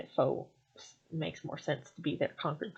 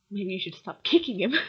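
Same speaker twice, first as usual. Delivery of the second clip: ordinary delivery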